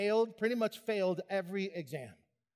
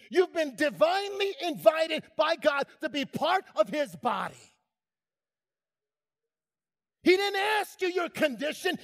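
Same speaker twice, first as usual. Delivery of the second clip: loud, high, fervent sermon climax; full-force preaching; 7 semitones higher, 5 dB louder than usual. Acted. Preacher crying out God's invitation to the church, impassioned. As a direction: shout the calling out to the congregation.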